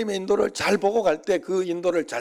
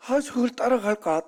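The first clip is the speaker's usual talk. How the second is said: higher, mock-tempted inner voice; tempted thought; 8 semitones higher than usual. Preacher voicing his own urge to chase the car, tempted. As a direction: wonder aloud whether to give chase.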